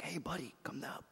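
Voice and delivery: whispered helicopter call. Acted. Rescuer in a helicopter calling the man down, whispered. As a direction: call him down in a hush.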